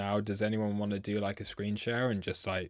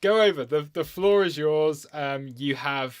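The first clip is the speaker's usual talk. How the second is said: needing the toilet; 5 semitones higher, 7 dB louder than usual. Real, not acted. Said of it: "otherwise I'm gonna wet myself".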